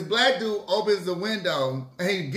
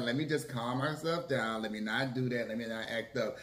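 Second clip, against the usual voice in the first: soft, lower murmur to herself; calming self-talk; 6 semitones lower, 9 dB quieter than usual. Retold or imitated. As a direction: talk yourself down quietly. Retold self-talk to stay calm.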